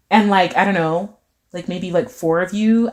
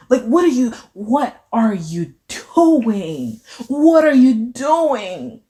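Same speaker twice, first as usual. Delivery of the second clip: each word of "what are you doing" slowly drawn out; heavy emphasis; 3 semitones higher, much slower than usual. Real, not acted. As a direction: stretch "doing" in theatrical disbelief. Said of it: "how the way I'm just emphasizing".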